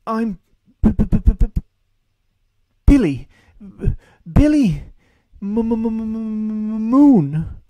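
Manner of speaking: high, loud, halting stutter; stammering. Acted. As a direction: force out each B and M. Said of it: "his own stammering".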